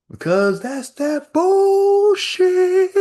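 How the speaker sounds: very high, loud, slow bullshit; drawn-out outrage. Real, not acted. Comedian rants about the rude lady, very high, loud and slow. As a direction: stretch it out in disbelief.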